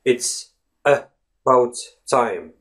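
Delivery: "It's... uh... bout... time", broken into slow separate pieces; drawn-out demo of "It's about time" with pauses. Shown as a demonstration. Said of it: "If you slow down your speech".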